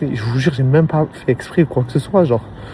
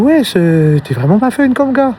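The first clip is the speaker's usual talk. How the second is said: high mock-exasperated tease; teasing reproach; 7 semitones higher than usual. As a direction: pitch jumps high on the scolding. Mock reproach to scooter guy.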